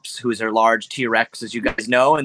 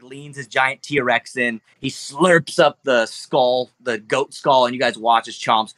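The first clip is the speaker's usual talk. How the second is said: normal talking voice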